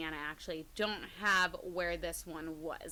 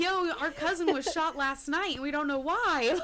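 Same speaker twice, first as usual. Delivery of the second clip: excited mock call; 7 semitones higher, 6 dB louder than usual. Voicing relatives in a joke.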